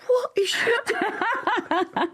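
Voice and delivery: high, slow, baffled question; a hammed-up, incredulous gasp of a question; bewildered onlookers. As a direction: play the embarrassed family staring in disbelief. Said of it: "they're all going".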